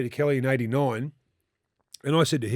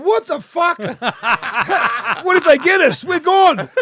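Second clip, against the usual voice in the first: high, loud mock panic with a laugh; laughing, hammed-up terror, much higher and louder; panicked shout; 13 semitones higher, 9 dB louder than usual. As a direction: yell it like the crowd is about to get you. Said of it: "we're going".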